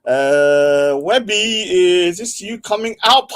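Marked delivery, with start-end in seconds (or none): none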